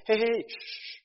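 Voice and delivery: acted crowd member silencing a nuisance; hushing someone. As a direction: shush him like an annoyed onlooker. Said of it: "these people are telling him".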